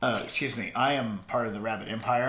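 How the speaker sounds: low, soft mock-British accent; bad British accent. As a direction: put on a hammy British gentleman's accent. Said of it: "just did a really bad accent".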